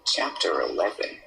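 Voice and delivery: loud, slow, halting read-aloud; laboured, projected narration; stilted story narration. Acted. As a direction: read the fanfic out loudly, word by word.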